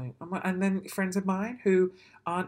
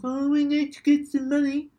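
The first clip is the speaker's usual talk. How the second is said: higher, put-on whiny network voice; hammed-up higher voice, held throughout; mocking TV network; 6 semitones higher, 6 dB louder than usual. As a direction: mimic a money-hungry broadcaster mockingly. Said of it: "They're like".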